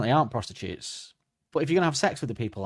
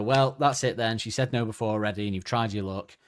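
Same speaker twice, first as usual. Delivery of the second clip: normal talking voice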